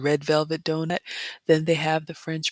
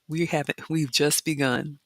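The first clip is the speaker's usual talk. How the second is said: normal talking voice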